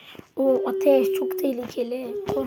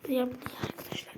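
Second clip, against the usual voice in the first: usual speaking voice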